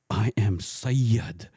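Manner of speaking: very slow, "Sayyid" hugely drawn out; mock-solemn announcement, milking "Sayyid"; heavy emphasis on Sayyid. Imitating the ticket guy giving his name, "Sayyid" slow and portentous. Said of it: "putting so much emphasis on Sayyid".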